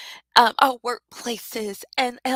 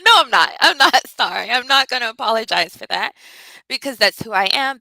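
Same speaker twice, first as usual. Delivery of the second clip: louder, laughing refusal to apologize; defiant, loud; 8 dB louder than usual. Real, not acted. Speaker tells viewers she won't apologize, loud and defiant. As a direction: own the emotion out loud. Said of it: "I went all emotional".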